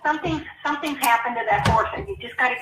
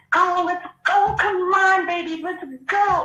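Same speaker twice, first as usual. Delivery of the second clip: higher-pitched, drawn-out cheer to a horse; joyful urging; 7 semitones higher, 3 dB louder, slower than usual. Acted. Trainer urging a horse to canter up a hill, joyful. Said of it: "and joyfully".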